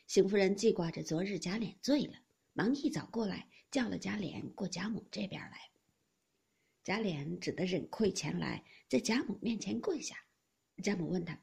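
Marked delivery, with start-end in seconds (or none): none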